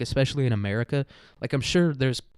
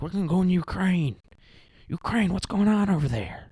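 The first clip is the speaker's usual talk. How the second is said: higher, put-on confused old politician voice; Bidened-out leader; 6 semitones higher than usual. Putin giving a muddled speech about Ukraine, confused and rambling. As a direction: drift off mid-speech, lost and vague. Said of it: "he's just completely Bidened out".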